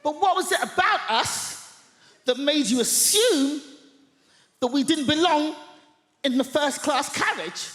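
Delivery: mock public put-down to the passenger; loud and pointed. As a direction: project it to shame her. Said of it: "at the top of my voice".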